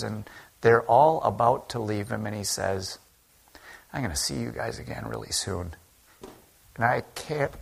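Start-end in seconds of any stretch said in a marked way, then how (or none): 3.9-7.5 tender. Acted. Jesus tenderly speaking to disciples.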